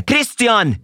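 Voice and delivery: high, piercing yell of the name; screamed name. Retold or imitated. Angry teacher screaming a student's name mid-class. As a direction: bellow his name at full force.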